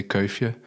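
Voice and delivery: "kuifje" said the Flemish way; "kuifje" with native Flemish sounds; Flemish pronunciation. Shown as a demonstration. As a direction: pronounce "kuifje" as roughly KOY-fyuh. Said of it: "in Flemish we say".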